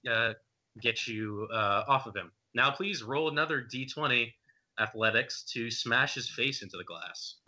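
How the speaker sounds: ordinary talk, nothing marked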